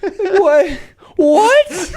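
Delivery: high, laughing double take; mock disbelief, laughing through it; stunned laughing what. Quoting her own confusion at the movie, laughing and stunned. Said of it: "What movie am I watching?".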